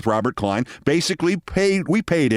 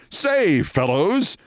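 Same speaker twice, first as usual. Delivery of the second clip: high hearty "Say, fellows" greeting; jolly old-school gent hailing the men; clubby three-piece-suit voice; mimicking Scott; 5 semitones higher, much slower than usual. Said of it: "who would call a group of men fellows".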